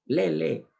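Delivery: a Quechua speaker calling Lili "Lele"; Andean vowel confusion. Shown as a demonstration. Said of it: "te dicen".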